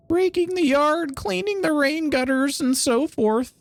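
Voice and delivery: put-on high, slowed old lady voice; old lady landlady. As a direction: list it off like an elderly landlady. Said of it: "Top tier old lady voice".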